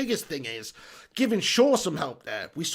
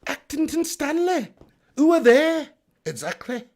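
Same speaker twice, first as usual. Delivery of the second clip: high, drawn-out mimicry, dropping for the answer; hammed-up advert voices, pitched way up then down; old advert impression; 9 semitones higher, 5 dB louder, slower than usual. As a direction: replay a two-voice advert catchphrase. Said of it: "Who's old enough to remember that advert?".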